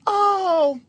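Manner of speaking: high-pitched wifely realization; a hint of the wife, high and drawn out; imitated wife. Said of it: "she goes".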